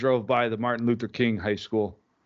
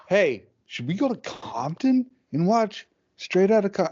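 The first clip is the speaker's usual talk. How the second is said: higher, slow buddy voice pitching a silly idea; eager buddy; 7 semitones higher than usual. Retold or imitated. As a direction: play the keen friend with a dumb plan. Buddy's suggestion acted out.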